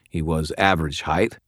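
ordinary delivery, nothing marked

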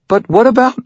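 anxious question; worried girl. Acted. Girl asks the wizard about his ring.

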